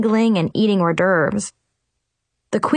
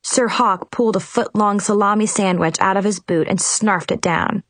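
usual speaking voice

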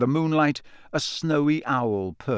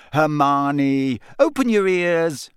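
louder, exasperated call; loudly; 3 semitones higher, 5 dB louder, slower than usual. Acted. Ron to Hermione, loud exasperated plea. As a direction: raise your voice to get through. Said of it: "said Ron loudly".

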